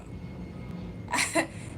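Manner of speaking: high-pitched laugh; real amused laughter. Real, not acted. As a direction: break into a high laugh after confessing. Laughs at her own admission.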